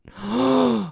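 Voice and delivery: sharp mock gasp; retold gasp. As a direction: gasp in shock. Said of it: "I read the story and I went".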